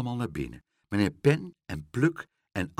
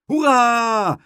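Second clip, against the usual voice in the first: high, loud hurrah; gleeful kids' whoop; cheering children; 11 semitones higher, 10 dB louder than usual. A narrator's reading. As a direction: cheer at full voice, drawn out. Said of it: "riepen de stampertjes en Aagje".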